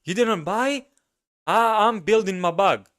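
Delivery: high incredulous mock voice, half laughing at him; mocking crypto bro. Mimicking the crypto guy who calls the speaker stupid, high and mocking. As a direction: scoff like a know-it-all who can't believe you didn't buy. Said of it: "he goes like".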